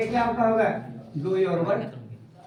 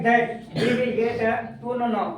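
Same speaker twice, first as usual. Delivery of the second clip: "That we will get to know now" said very slowly, word by word; slow dictation; much slower than usual. Shown as a demonstration. Teacher dictating the English line to class, very slow. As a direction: spell it out slowly for students. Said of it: "क्या लिखा है".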